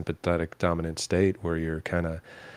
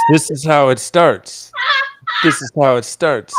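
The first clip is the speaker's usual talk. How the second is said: mock ominous warning; 7 semitones higher, 8 dB louder than usual.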